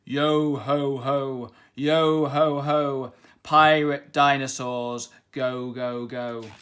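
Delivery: slow, loud monster chant; pirate dinosaurs singing their song; terrible dinosaur voices. A narrator's reading. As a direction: growl the shanty like beasts. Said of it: "in their terrible voices".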